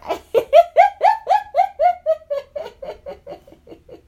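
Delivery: loud, very high sustained giggling; real squealing laughter, trailing away; long delighted giggle. Real, not acted. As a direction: giggle helplessly in a high, rapid run. Delighted at toddler's word.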